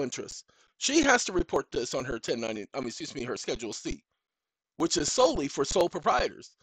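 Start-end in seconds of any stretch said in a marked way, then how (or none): none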